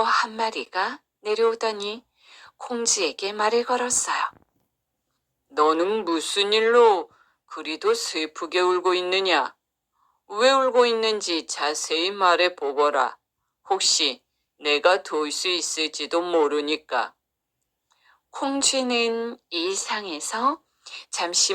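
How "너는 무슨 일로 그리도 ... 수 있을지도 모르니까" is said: low-voiced magical cow asking what is wrong; low, stately talking cow. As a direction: speak as a solemn animal helper.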